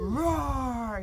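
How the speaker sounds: a big, loud playful roar; dinosaur roar. Acted. Boy playing with toy dinosaurs, loud playful roar. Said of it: "he said, pretending to make a big dinosaur eat".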